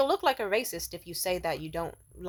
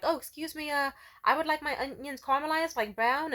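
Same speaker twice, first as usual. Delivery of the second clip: higher, polite imitated request; politely flag down the staff, pitched up; polite customer; 7 semitones higher than usual. Retold polite request at counter.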